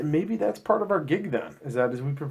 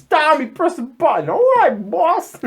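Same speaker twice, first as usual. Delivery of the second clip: high, loud cartoonish exchange; higher, louder mock henchman banter; comic henchman voices; 12 semitones higher, 5 dB louder, slower than usual. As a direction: play a boss and sidekick for laughs. Acting boss and Tommy Buttons.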